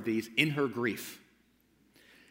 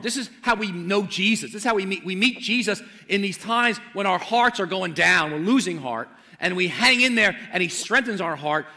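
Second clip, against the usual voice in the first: loud, urgent preaching, rising high on key words; fervent preaching; 7 semitones higher, 8 dB louder than usual. Acted. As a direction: preach it out with full conviction. Preaching at full force.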